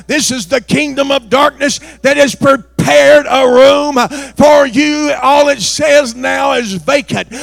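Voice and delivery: fiery revival shouting; preaching at full force. Acted. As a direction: thunder the warning at the pews. Preacher building to a climax.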